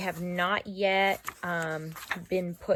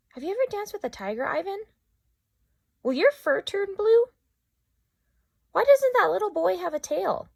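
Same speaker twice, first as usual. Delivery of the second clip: high, fast, excited little elephant voice; little girl Ruby; 14 semitones higher, 7 dB louder, faster than usual. Acted. Young Ruby pestering Ivan with questions, high and eager. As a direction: bounce up high like an eager child. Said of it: "questions she loved to ask".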